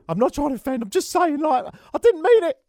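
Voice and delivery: high-pitched, whiny plea; over-the-top defensive squeal; mock apologetic protest.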